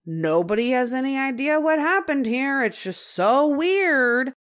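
imitating a puzzled man, stretching it's just so weird; lightly imitated baffled neighbor; bewildered. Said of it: "saying it in this way that's like".

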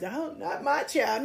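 high, slow, drawn-out mock parent; lightly voiced disbelieving parent; mock denial.

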